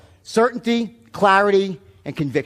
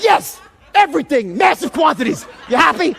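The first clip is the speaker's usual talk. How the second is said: high, loud sarcastic confession; retold outburst, throw it at them; exasperated; 8 semitones higher, 5 dB louder than usual. Customer confessing all drug use to the agent, exasperated. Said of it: "Now I get really pissed".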